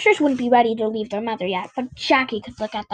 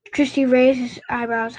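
ordinary delivery, nothing marked

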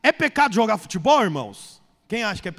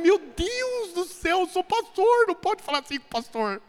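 shocked; 6 semitones higher than usual. Retold or imitated. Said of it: "Eu falei".

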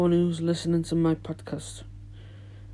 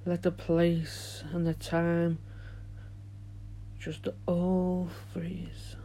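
soothing guided relaxation, fading quieter; guided meditation; 9 dB quieter than usual. Acted. Leads a meditation.

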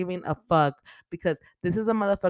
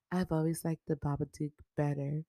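deadpan killer sister; 2 semitones lower, 7 dB quieter than usual. Retold or imitated. And she's like.

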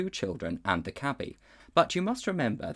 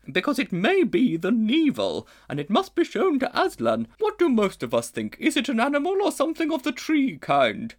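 a grand, ponderous lady elephant; she-elephant; 14 semitones higher, 6 dB louder than usual. A narrator's reading. She-elephant pondering the strange creature.

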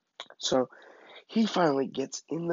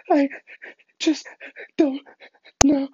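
hiccuping sobs between each word; mock full cry; 14 semitones higher, 6 dB louder than usual. Frantic kid scared of his parents, imitated sobbing stutter. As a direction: gasp it out word by word, imitating crying. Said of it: "I'm, like, in full cry".

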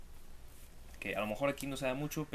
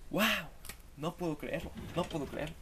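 mock amazement; 6 semitones higher than usual. Mock reality-show amazement.

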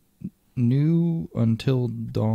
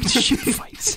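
laughing whispered echo; whisper yelling; 13 semitones higher than usual. Retold or imitated. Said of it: "Why are we whispering?".

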